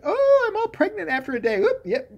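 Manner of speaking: very high, chirpy voice; mock ditzy heroine. Mocking the heroine about her sudden baby, high and chirpy.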